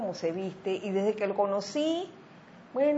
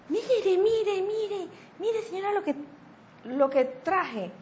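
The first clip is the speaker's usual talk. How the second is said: eager man; 11 semitones higher, slower than usual. Retold or imitated. Retold eager man with a box.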